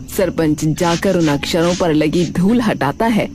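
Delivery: plain reading voice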